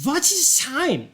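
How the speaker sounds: high, slow rhetorical question; high theatrical old man's voice; old lecturer in character. Acted. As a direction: draw it out as a grand, high-pitched lecturer. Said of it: "But as he says".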